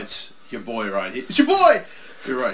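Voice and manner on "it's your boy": loud, high-pitched cheer; excited shout. Real, not acted.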